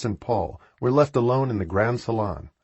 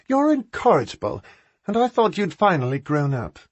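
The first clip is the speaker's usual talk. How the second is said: exasperated; 9 semitones higher, 3 dB louder than usual. A narrator's reading. Sister glaring at brother.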